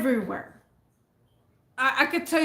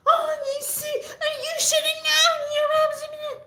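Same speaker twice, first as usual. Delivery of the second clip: hammed-up shrieking woman; screeching lady; faster than usual. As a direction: rant in a squeaky falsetto. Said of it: "she just kept saying".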